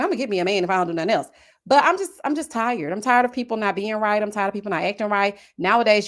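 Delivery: ordinary talk, nothing marked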